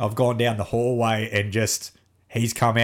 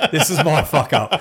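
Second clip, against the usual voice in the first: laughing admission; 4 semitones higher, 5 dB louder than usual. Retold or imitated. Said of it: "And I'm like".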